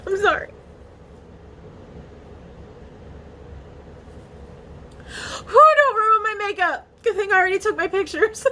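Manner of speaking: high, breaking voice through real tears; telling her viewers about the new treatment, crying happy tears. Real, not acted. Real happy sobbing, voice cracking high. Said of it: "I started crying happy tears".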